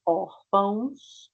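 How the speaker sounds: órfãos drawn out slowly; careful teacher example; slow demo. Shown as a demonstration. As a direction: show the ãos ending clearly. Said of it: "pode ser assim".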